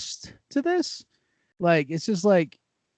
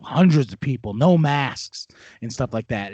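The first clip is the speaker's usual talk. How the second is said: ordinary talk, nothing marked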